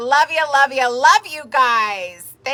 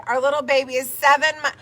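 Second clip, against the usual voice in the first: normal talking voice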